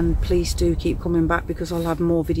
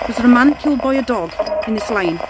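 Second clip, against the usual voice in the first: calling questions out; 4 semitones higher than usual. Real, not acted. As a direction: call each question out into the dark. Questioning spirits aloud.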